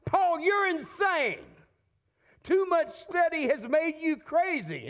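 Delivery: high, slow mock outburst; half-hearted shout of Festus; a preacher acting out an angry outburst; retold shout. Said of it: "Suddenly Festus shouts".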